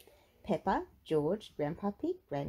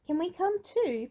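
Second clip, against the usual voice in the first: Peppa voice; 11 semitones higher, 4 dB louder than usual. Acted.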